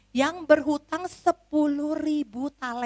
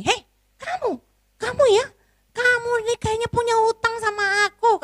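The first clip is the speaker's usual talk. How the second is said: much higher, harsh calling out; fierce servant; 11 semitones higher, 3 dB louder than usual. Acted. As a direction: confront the debtor aggressively.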